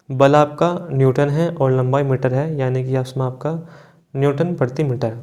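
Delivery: usual speaking voice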